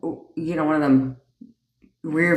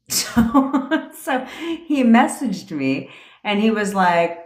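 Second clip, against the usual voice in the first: normal talking voice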